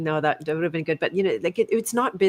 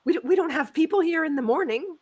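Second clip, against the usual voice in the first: imitated indignant teller; 11 semitones higher, 5 dB louder than usual. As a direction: mimic an affronted teller, pitched way up. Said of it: "Like that".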